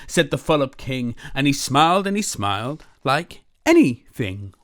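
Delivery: lively, loud read-aloud storytelling; animated picture-book reading; story narration. A narrator's reading. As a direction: read it big and bouncy for kids.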